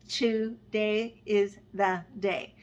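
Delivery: "Today is the day" drawn out slowly, each syllable set apart; slow syllable count. Shown as a demonstration. Teaching viewers to count haiku syllables, slowly spelled out. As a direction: count the beats out one by one. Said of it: "a cute way to find out how many syllables".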